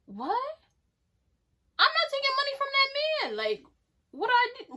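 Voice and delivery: squeaky high disbelief; high, fast, stunned protest; incredulous. Real, not acted. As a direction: shoot way up in pitch, baffled. Outraged disbelief at the offer.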